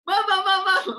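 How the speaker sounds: high, loud, rapid calls for the teacher's attention; squeaky eager student begging to answer; eager pupil calling. Retold or imitated. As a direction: play the hand-waving know-it-all. Imitating eager classmate calling teacher.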